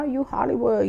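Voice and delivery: ordinary talk, nothing marked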